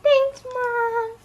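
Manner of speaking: high, loud, happy thanks; excited thanks. Real, not acted. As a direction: gush your thanks to mom. Thanks mom for the gift.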